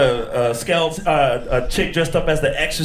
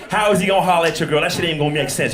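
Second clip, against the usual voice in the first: ordinary talk, nothing marked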